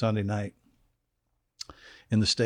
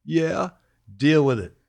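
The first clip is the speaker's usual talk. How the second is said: higher-pitched dismissive coach retort; Buddy; 6 semitones higher, 3 dB louder than usual. Retold or imitated. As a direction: imitate a coach brushing off a complaint. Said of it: "And Buddy'd say".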